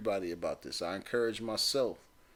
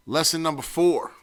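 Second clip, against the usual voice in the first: usual speaking voice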